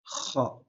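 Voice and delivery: "Kha" as a breathy, rasping throat sound with no voice; exaggerated kha. Shown as a demonstration. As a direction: hiss "Kha" hard from the back of the throat. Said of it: "Le kha, tu exagères plus".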